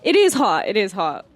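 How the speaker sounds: usual speaking voice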